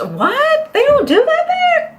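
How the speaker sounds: high, squeaky shocked question; mock disbelief. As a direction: play the stunned American hearing it. Mock shocked listener reaction.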